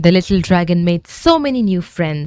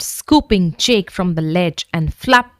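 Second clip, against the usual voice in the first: ordinary delivery, nothing marked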